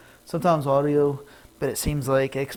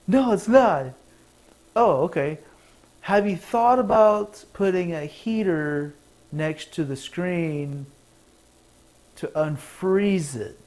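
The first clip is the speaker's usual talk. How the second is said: higher, louder acted support call; mock phone chat; 4 semitones higher, 5 dB louder than usual. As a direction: ham up the helpful agent.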